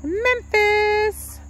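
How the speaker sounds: high, drawn-out name call; calling a dog. Real, not acted. Calls her dog.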